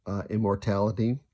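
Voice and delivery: ordinary delivery, nothing marked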